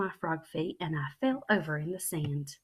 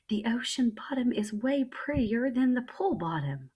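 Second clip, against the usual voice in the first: put-on delighted child calling out, higher and louder; excited holler; 6 semitones higher, 5 dB louder than usual. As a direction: gush about the view. Excited child on seeing ocean.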